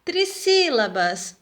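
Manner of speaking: normal talking voice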